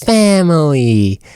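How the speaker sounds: slow, drawn-out mock sincerity; syrupy slow moral-of-the-story delivery; spelled out. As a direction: stretch it as if teaching a five-year-old. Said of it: "have to say it vocally".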